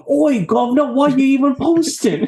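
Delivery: loud, high, hammed-up fake Australian; badly faked Australian, called out high; mock Australian accent. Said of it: "he did it in an Australian accent".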